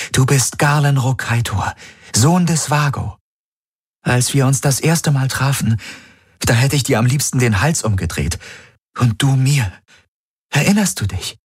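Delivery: high, urgent reminiscence; imploring. A narrator's reading. Woman reminding her friend who he is, imploring. She pleads for him to remember.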